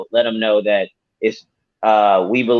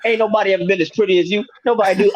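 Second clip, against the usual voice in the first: much higher, fast, laughing hype-man flattery; 7 semitones higher than usual. Retold or imitated. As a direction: gas him up like a hype man. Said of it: "So I need a hype man too".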